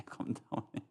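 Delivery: a tender whispered hush; whispered calm down. Retold or imitated. Repeated as a hush.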